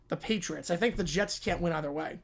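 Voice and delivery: normal talking voice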